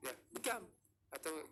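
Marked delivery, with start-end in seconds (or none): 0.3-0.6 Bikam with a sharp high rise at the end; rising question. Shown as a demonstration. Teacher showing the question tune for Bikam. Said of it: "intonasi nada yang menunjukkan bahwasannya itu sebuah pertanyaan".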